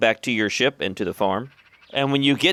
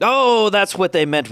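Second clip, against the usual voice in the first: sudden realization; 8 semitones higher, 3 dB louder, slower than usual. Acted. Orc realizes what airship means.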